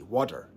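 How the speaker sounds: Water with the T tapped like a quick D; flapped T water. Shown as a demonstration. Teaching the flapped T to viewers, tapped T. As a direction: say Water with a D-like tap. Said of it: "That's the flapping T".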